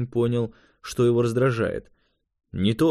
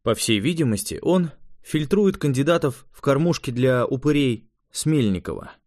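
ordinary delivery

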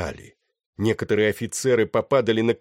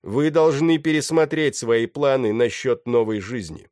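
raised, loud public address; proclaiming; 5 semitones higher, 6 dB louder than usual. A narrator's reading. Pirate to the passengers, calling out loudly. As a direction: project it to the whole deck.

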